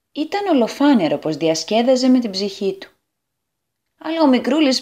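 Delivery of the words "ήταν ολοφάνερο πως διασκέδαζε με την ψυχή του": plain reading voice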